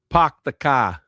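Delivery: "park the car" with no r, "pahk the cah"; Boston accent. Retold or imitated. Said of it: "That's all I know how to say in Boston".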